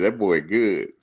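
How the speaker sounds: lightly put-on country accent; Coach Bowden. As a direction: mimic a country coach's admiration. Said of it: "he had the real country accent".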